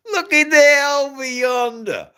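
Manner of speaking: playful Southern American imitation; Southern accent. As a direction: put on a big Southern accent, laughing.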